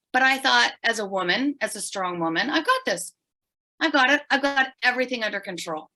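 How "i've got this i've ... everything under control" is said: high, brisk self-assured bravado, lightly imitated; a hint of her younger self insisting she's fine; mock confidence. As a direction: play the strong woman who has it all handled. Quoting her past confident self.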